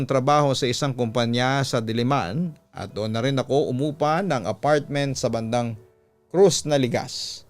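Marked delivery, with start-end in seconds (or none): none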